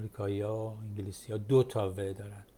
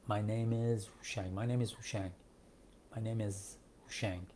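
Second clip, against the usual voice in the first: "my name is" run together, softer; quick, linked form; 6 dB quieter than usual. Shown as a demonstration. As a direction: say "my name is هوشنگ" as one quick connected phrase.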